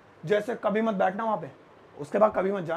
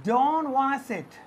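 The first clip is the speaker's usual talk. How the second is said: very slow model phrase, higher; slow demo; 4 semitones higher, much slower than usual. Shown as a demonstration. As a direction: drag it out so learners catch it. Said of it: "इसे हम English में बोल सकते हैं". (बहुत धीरे उदाहरण)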